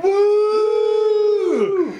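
long, high held whoop; thrill-ride woo. Acted. Host acts out passenger plunging in the taxi, thrill-ride whoop. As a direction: whoop like riding a drop ride. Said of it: "raises his hands like he's on the fucking demon drop".

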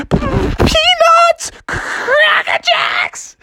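very high, trembling vendor cry; hammed-up quavering falsetto hawker; shaking with nervous excitement. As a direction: squeal the sales call with jitters, dragging it out. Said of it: "he started shaking".

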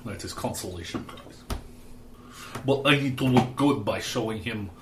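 put-on Russian accent. Candidate plotting with his brother-in-law, in a Russian accent. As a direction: plot coolly in a thick Russian accent. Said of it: "I find it deeply satisfying to do the Russian accent".

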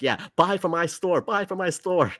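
high-pitched, eager sales pitch; pushy retailer. Retold or imitated. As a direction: mimic a store begging for buyers. Mimics retailers pushing pre-orders.

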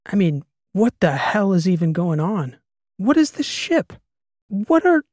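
tumbling into frantic bewilderment; spiralling questions. A narrator's reading. Karsten demanding answers from Karolina, frantic and climbing.

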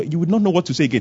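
ordinary talk

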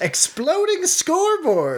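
high, drawn-out incredulous echo; baffled, stretched-out question; real astonishment. Real, not acted. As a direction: repeat it in high-pitched disbelief. Astonished at hearing of exploding scoreboard.

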